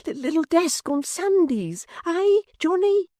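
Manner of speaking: pleading with a dazed friend to recognise him; anxious little clerk. A narrator's reading.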